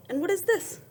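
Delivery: put-on high, bright teacher question to the kids; excited question. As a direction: lift the voice high and curious. Teacher asks children about cover.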